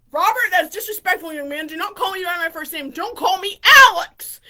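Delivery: imitated indignant stepfather; stern scolding. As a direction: start loud, build to an exclaimed last name. Stepfather scolds son in story.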